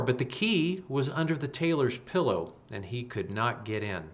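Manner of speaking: ordinary delivery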